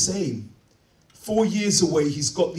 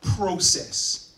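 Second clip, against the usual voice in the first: ordinary talk, nothing marked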